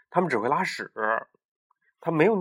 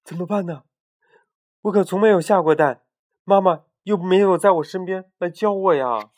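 nervous thoughts; 5 semitones higher, 6 dB louder than usual. Acted. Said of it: "紧张得直打颤 心想".

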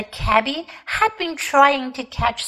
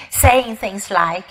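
ordinary delivery, nothing marked

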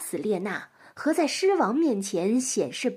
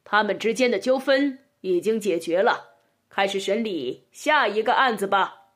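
slightly lowered, firm royal announcement; impassive lion king; 2 semitones lower, 3 dB louder than usual. A narrator's reading. Lion king closing the duel case, impassive announcing voice. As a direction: pronounce the verdict as an unmoved ruler. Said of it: "面无表情地宣布了".

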